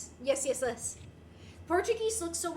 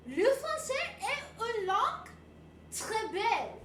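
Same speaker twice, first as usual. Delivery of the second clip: 1 broken French; 5 semitones higher than usual. Acted. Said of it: "That was the most broken French ever".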